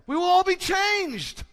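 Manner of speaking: very high, loud, slow shout on all; full-voice proclamation; triumphant shout. Shown as a demonstration. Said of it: "And he didn't say it like that".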